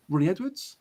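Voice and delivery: baffled echo of a name read out; puzzled question. Real, not acted. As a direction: ask the name back as if you can't place him. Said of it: "hang on".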